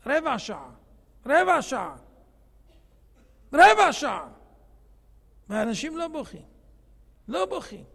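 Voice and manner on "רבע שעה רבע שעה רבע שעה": building emphasis. Real, not acted. Preacher pounding the short time home to listeners, growing louder. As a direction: say it three times, each one much louder.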